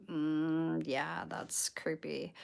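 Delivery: soft, slow, drawn-out unease; hushed, hesitant acted response; uneasy reaction. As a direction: murmur it slowly, retelling a creeped-out reaction.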